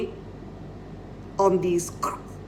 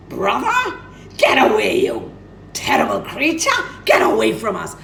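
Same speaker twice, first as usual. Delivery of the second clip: loud, high shrill rejection; angry peacocks; 3 semitones higher, 6 dB louder than usual. Acted. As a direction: drive him off with disgust. Peacocks drive away Ivanov.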